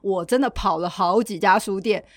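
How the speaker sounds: ordinary delivery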